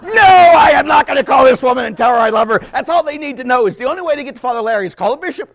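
high, loud protesting shout; retold outraged refusal. Bishop refusing to call the woman, high shouted protest. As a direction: burst out with a mock-appalled no.

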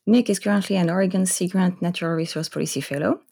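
ordinary delivery

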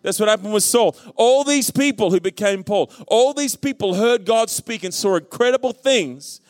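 high-pitched, building sermon climax; rousing preaching. Real, not acted. Preacher urging congregation to hear God speak, rousing preaching. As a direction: preach it in rising, insistent repetitions.

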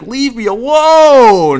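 loud, high yell, drawn out very slowly; retold yell. Yelling at the girl behind him, long high holler. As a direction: holler it and stretch the last word. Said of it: "yelled at her".

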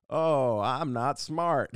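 dumb blue-collar guy. Acted. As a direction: drag it out like a dim working man. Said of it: "a blue collar".

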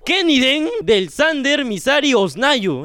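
donor announcement. Real, not acted. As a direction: draw the name out like an MC. Anuncia el nombre de un donante. (nombre anunciado lento y más agudo)

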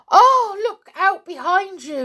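high, loud alarm; yelled warning. A narrator's reading. Julian points behind Cecil in a prank, yelling. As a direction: yell it to startle someone. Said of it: "yelled Julian".

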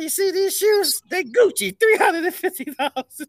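high boastful strutting promo voice; Flair bragging. Retold or imitated. Host quoting Flair's promo to cohosts, high bragging voice. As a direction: brag like a flashy old wrestler. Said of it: "He said".

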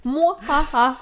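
spooky evil laugh; mad scientist cackle. Pretend. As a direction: cackle like a villain.

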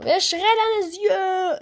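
high excited cheer; hyped up. Real, not acted. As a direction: cheer it out loud. Hyped shout. (aufgedrehter Jubel)

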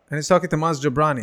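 ordinary delivery

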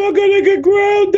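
high, hammed-up wail; panicky cartoon character. Cartoon character impression exchange.

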